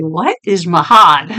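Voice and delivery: baffled by a chat message; mock puzzled. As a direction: stretch out the confusion over Mahad. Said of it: "I'm like".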